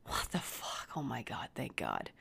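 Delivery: low, breath-only whisper of relief. Retold or imitated. Parent spotting the missing son on the street, whispered relief.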